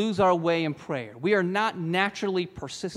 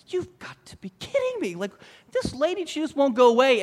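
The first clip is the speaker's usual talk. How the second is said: exasperated judge; 7 semitones higher than usual. Retold or imitated. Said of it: "He's like".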